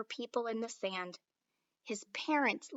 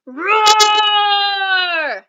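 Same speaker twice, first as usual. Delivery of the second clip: long, much higher and louder roar; dragon bellow; 9 semitones higher, 16 dB louder than usual. Acted. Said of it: "bellowed the dragon".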